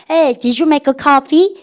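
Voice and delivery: very slow, rising on coffee; slow demo question. Shown as a demonstration. Teacher shows class a sentence, slowly. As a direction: stretch each word out like a model line. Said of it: "자, 보십시오".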